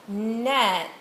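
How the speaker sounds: "Net" with its sounds stretched out; said slowly. Shown as a demonstration. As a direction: draw out "Net" for the listener. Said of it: "say the word slowly".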